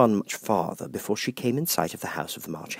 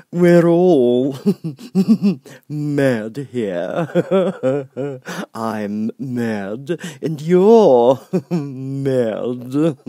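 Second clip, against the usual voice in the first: grinning Cheshire Cat; 6 semitones higher, 7 dB louder, much slower than usual. Acted. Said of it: "said the cat".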